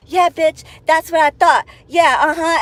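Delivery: high, loud gloating jeer; mock gloat. Woman gloating from her car after the fight, loud jeer.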